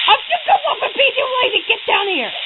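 high fast call to a teammate. Real, not acted. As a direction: yell it quickly at your partner. Calling to a lazy teammate.

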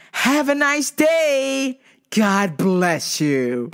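very drawn-out customer-service farewell; sugary send-off. Retold or imitated. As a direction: stretch every word with overdone politeness. Teller's exaggerated polite send-off to customer.

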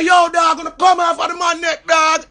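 high, loud plea; shouting at cop. Retold or imitated. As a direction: yell at him to get off the neck. Pleading with officer, shouting.

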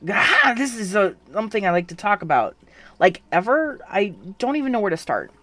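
can't bring yourself to open up; flustered reluctance. Acted. Voicing the narrator-you character.

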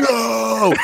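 high, drawn-out mock wail; hammed-up cartoon cry of dismay; mock dramatic cry. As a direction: cry it out high, imitating melodrama.

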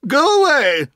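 put-on the aunt snaps at a ragged boy; cross. A narrator's reading. Aunt shoos the boy off, crossly. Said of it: "she said crossly".

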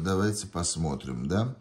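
usual speaking voice

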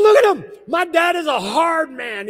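loud, high, furious shout; angry yell. Retold or imitated. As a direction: yell it at the pastor like an upset daughter. Angry daughter yelling at pastor.

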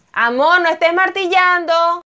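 loud call to another room. Real, not acted. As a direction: call out over the noise. Calling out to husband hammering.

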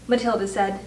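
ordinary delivery

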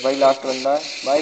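ordinary talk, nothing marked

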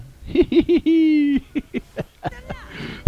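long high giggling laugh; high giggle. Real, not acted. Baby reveal.

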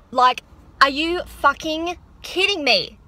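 overwhelmed with excitement after the salon; emotional outburst. Real, not acted. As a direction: let the thrill burst out slowly. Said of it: "I'm sorry if I'm getting, like, emotional".

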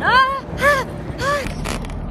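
high squeals of delight; excited squeals. Real, not acted.

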